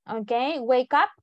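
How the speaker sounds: ordinary talk, nothing marked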